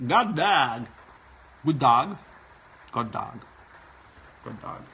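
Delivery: hammed-up Swedish sing-song greeting; attempted Swedish greeting. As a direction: try out a Swedish accent.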